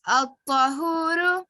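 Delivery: slow, deliberate teacher recitation. Shown as a demonstration. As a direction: sound each syllable out slowly. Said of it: "Repeat after me".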